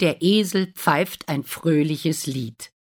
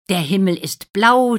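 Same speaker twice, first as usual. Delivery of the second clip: plain reading voice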